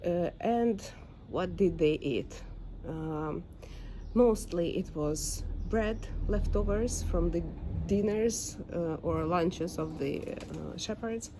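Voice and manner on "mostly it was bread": normal talking voice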